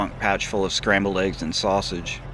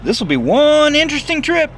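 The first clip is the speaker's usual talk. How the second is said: much higher, loud bus driver calling down; Dwayne's voice; 9 dB louder than usual. Acted. Dwayne calls down from the bus, loud and high. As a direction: call it down the stairs, hooting.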